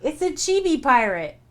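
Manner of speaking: giggly cute announcement; delighted chibi pirate reveal; gleeful reveal. Real, not acted. Joking reveal.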